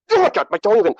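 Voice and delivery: high exasperated cry. Real, not acted. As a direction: throw up your hands. Exasperated outburst.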